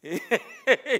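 laughing through the words. Real, not acted. Preacher laughing at his Boston story, loud. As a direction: chuckle as you go on.

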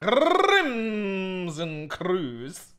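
very slow, drawn name; stretched out. Pretend. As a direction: savor the name slowly. Stretched correction.

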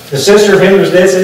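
ordinary delivery

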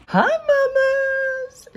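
very high, slow cooing to a pet. Real, not acted. Cooing high and slow at her fish in the tank.

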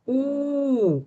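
у drawn out high, falling at the end; sound held long. Shown as a demonstration. Teacher pronouncing the sound with children, held long. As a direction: stretch the vowel у. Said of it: "Давайте вимовимо звук".

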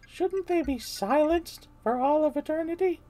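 high, very slow and drawn out; eerie princess voice. Acted. Brainwashed Peach questions Mario, high and eerie. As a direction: float it up high, creepy and slow.

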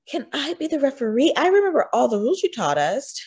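high-pitched, hurried pleading; begging. A narrator's reading. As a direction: plead like a kid angling for a way out. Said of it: "Yasmin begged".

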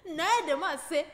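normal talking voice

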